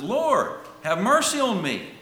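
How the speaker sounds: loud, high plea for mercy; crying out. Retold or imitated. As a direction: call out to be heard, pleading like a retold beggar. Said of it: "he cries out and says".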